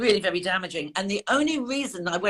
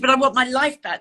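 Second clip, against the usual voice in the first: higher, louder repeated protest; insistent plea; 5 semitones higher, 5 dB louder than usual. Retold or imitated. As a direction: insist on it, retelling a desperate plea.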